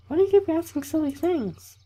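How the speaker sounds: very high falsetto camp leader brushing off a question; badly faked female voice, mildly scolding; bad girl voice. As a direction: dismiss it in squeaky mock female falsetto. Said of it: "That was a really bad girl voice".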